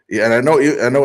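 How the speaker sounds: ordinary delivery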